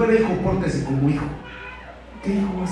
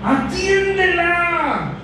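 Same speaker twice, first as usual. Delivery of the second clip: higher, slow whiny plea about the woman; mock complaining disciples; 13 semitones higher, slower than usual. Disciples pestering the Lord about the shouting woman, high and whiny. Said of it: "los discípulos le decían al Señor".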